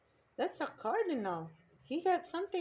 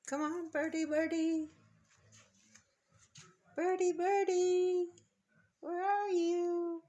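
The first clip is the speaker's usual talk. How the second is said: high sing-song bird call; coaxing a wild bird; 5 semitones higher than usual. Real, not acted. Calling a bird to the feeder.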